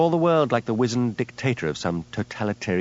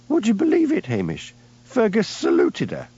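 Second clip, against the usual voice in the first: giggling; 12 semitones higher than usual. Acted. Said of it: "Priscilla exploded into giggles".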